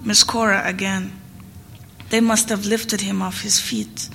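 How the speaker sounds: ordinary delivery, nothing marked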